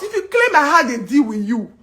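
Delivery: ordinary delivery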